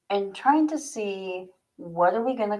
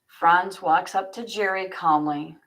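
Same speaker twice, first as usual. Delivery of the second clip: ordinary delivery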